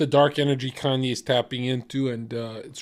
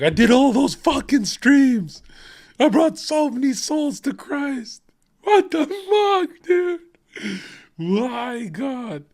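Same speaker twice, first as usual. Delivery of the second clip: very high, louder, slowed complaint; indignant mock rant; 11 semitones higher, 5 dB louder, slower than usual. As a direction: whine it in hammed-up betrayal. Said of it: "Be like".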